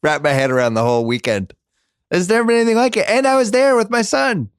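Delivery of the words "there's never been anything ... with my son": high, eager rush of pride; excited. Real, not acted. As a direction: relive the win, buzzing. Reliving the World Series win.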